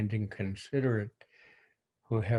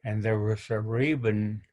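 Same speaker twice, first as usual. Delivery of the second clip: ordinary delivery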